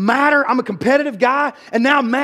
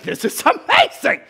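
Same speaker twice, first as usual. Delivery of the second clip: delighted; 7 semitones higher than usual. Retold or imitated. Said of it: "I was like".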